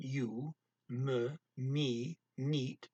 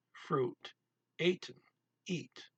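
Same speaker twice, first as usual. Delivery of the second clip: ordinary talk, nothing marked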